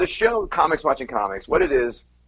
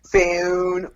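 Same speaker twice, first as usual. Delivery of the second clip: South Jersey "Phone" as "feh-own"; South Jersey "Phone" drawn out; South Jersey accent; 7 semitones higher than usual. Shown as a demonstration. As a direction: give "Phone" the Philly vowel. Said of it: "key words here to listen for".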